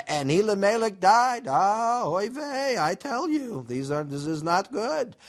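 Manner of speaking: put-on high, lamenting Yiddish accent.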